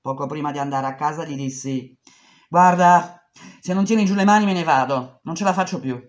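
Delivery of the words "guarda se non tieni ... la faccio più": angry warning. Retold or imitated. She confronts the boss.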